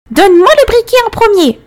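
put-on high, loud furious old witch; screeching crone demand; angry witch voice. A narrator's reading. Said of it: "La vieille dame arrêta de le hisser".

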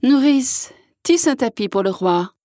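bossy princess giving orders; lightly voiced bossy order; commanding. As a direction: order the nurse around haughtily. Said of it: "Elles commandèrent".

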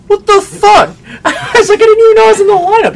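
high, loud disbelief with a laugh; shocked. Retold or imitated. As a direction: squeal it in total shock.

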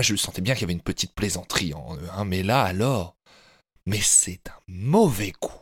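ordinary talk

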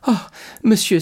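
high, loud anguished cry. A narrator's reading. Mercédès cries out to Monsieur Morel, high anguished cry. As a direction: burst out in distress. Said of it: "s'écria Mercédès".

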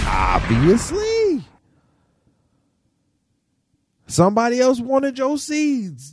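pat him on the head with your voice; mock soothing. Mock-consoling [name].

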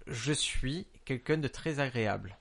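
slow, measured delivery; slow dictation. Shown as a demonstration. Giving the phrase to translate, slow dictation. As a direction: dictate it slowly, word by word.

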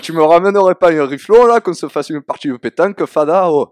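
loud, high, exaggerated Provençal accent. Shown as a demonstration. Southerner performing on request for the host, hammed-up Provençal accent. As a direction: belt out a full-on singsong Provençal accent. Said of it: "ton plus bel accent provençal".

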